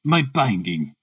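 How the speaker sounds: put-on deep, formal German-accented delivery. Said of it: "harsh voice with a strongly marked German accent".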